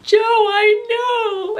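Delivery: high, drawn-out mock wail; mock tearful freak-out. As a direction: wail back in exaggerated shared despair. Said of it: "freak out and cry together".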